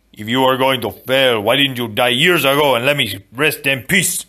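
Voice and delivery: a dead father scolding his son; angry father. Acted. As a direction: reject the apology with contempt. Father's ghost rebuking Inigo.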